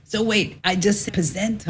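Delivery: a hint of mock Brooklyn accent. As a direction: quote mom with a touch of Brooklyn. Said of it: "in that Brooklyn accent".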